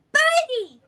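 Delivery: high, loud delighted squeal; excited child. Acted. Little sister spotting the pigeon, squealing high and loud. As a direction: shriek it like a small girl spotting a bird. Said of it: "she kinda goes".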